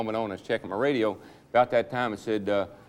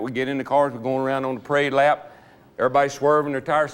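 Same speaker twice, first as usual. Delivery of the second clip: usual speaking voice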